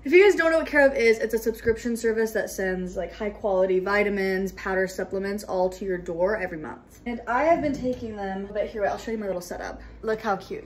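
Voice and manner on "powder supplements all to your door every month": usual speaking voice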